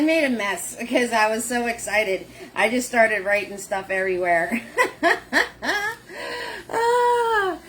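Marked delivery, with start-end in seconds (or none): 5.0-7.6 high squealing laugh fading to ah; laughing hard. Real, not acted. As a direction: crack up at yourself. Laughs at own excitement.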